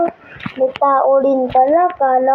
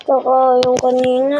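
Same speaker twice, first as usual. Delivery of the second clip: usual speaking voice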